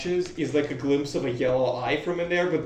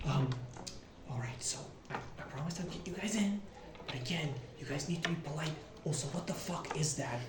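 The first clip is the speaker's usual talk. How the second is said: hushed, much softer aside; quiet; 11 dB quieter than usual. Acted. Promising to get the party in unnoticed, quiet. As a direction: keep it down, almost under your breath. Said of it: "he says it quietly".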